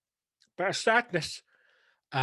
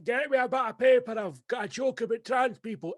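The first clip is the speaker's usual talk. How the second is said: high, loud, giddy burst of inspiration; mock-excited shout for paper; excited inner voice; 10 semitones higher, 3 dB louder than usual. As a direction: squeal it like a sudden brainwave. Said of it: "I was like".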